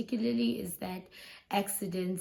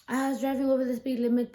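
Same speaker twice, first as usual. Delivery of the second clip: fast repeat; 3 semitones higher, faster than usual. Retold or imitated. Repeats the admission aloud.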